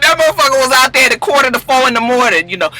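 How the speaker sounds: normal talking voice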